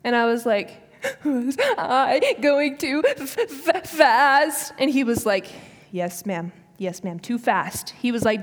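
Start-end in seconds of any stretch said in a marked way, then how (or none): 1.0-4.7 slow, sob-broken stammer; mock blubbering. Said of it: "I'm, like, just sobbing. I'm blubbering". 5.9-7.9 imitated police officer. Police officer answering sobbing driver.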